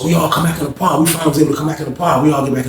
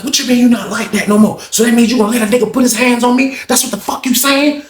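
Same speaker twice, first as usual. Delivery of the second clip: pitched-up cussing BB; high mock cursing; 8 semitones higher than usual. BB cursing at Lawrence in a high mock voice. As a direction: snap back in a high put-on voice.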